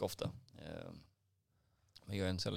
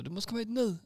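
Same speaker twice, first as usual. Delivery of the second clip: high, loud imitation of an angry customer; mock-furious high yell; mock screamed demand; 5 dB louder than usual. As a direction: demand it like a raging client. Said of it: "han bara skrek".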